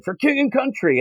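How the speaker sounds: high mock rallying call; battle cry. Knight charging into battle, high mock cry. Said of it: "He just said".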